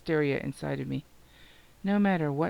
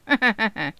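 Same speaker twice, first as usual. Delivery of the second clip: sudden loud burst of laughter, higher; hysterical laugh; 7 semitones higher, 8 dB louder than usual. Acted. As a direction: laugh at the absurdity of it all, loud and bright. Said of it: "I started laughing".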